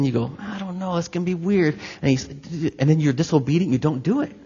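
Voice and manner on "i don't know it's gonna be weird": soft, hesitant excuse; mock a reluctant reply under your breath; reluctant mumble. Acting out his reluctant reply to God.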